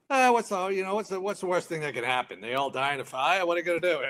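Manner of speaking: mock-callous joking. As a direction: toss it off like a grim joke. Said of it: "they were joking around".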